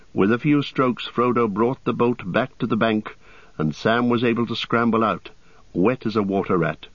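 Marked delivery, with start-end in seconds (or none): none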